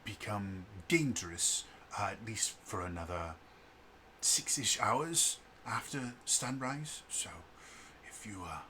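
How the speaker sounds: soft, measured officer addressing the group; brief them quietly and formally; Declan. Acted. Game master voices Declan.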